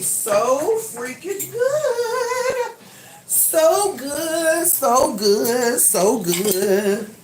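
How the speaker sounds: high, slow, gushing excitement; excited squeal. Real, not acted. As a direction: squeal it out with delight. Excited over ice cream, squealing.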